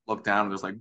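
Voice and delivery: normal talking voice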